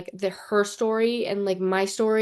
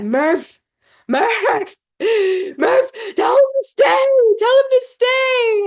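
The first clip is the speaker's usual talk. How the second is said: high, loud desperate calling out; pleading movie yell; 8 dB louder, slower than usual. Acted. Reliving the Interstellar scene for viewers, calling out high and loud. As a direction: cry out the name as if across time.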